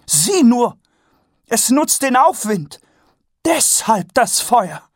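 thrilled lady spotting the updraft; Lady Ramkin, high and excited. A narrator's reading. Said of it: "in ihren Augen glühte Aufregung".

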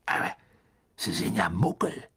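hushed, husky amazement; delighted wonder. A narrator's reading. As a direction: marvel under your breath. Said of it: "sagte Mister Weasley entzückt".

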